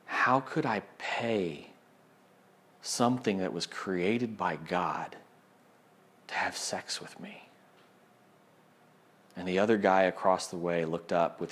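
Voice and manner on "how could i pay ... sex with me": slow, soft, near whisper, holding back tears; tearful. Retold or imitated. Ashamed young man confessing to Caleb, tearful near-whisper. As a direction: let it break quietly on the last words. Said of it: "his eyes brimmed with tears".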